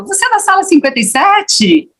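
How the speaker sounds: lightly voiced high curious question; curious asker. Imitating a person asking.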